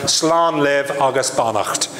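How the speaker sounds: ordinary delivery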